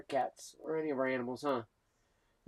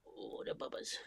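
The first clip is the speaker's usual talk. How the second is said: whispered teasing; 10 dB quieter, slower than usual. Retold or imitated. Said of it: "You always do the Bubbas".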